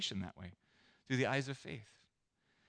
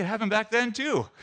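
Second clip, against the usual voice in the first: ordinary talk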